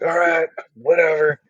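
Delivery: high, bored brush-off; mock dismissive. Hikers brushing off the neighbour's complaint, high mocking dismissal. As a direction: shrug it off like a teenager.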